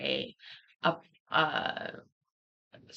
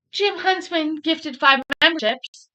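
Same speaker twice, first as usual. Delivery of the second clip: excited alert read; 9 semitones higher, 5 dB louder than usual. Retold or imitated. Reading a stream alert.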